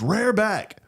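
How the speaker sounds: high, urgent imitation of dad; acted command. Dad telling son to wind up, acted high command. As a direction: order him like an insistent father.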